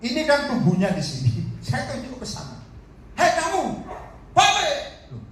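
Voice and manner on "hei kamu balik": high-pitched calling out; acted summons, high and forceful; commanding call. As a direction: order someone back from a distance, voice pitched way up. Acts out ordering a spirit back.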